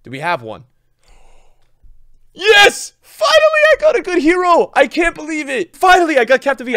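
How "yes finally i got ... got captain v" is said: high, loud cry of relief; overjoyed outburst. Real, not acted. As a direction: yell with relief at long last. Finally gets a good hero.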